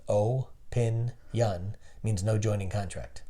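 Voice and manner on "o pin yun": O, pin, yun said as separate slow syllables; syllables split apart. Shown as a demonstration. Man explaining a word to his listener, splitting syllables slowly. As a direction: break opinion into O, pin, yun. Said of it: "when you break down the word".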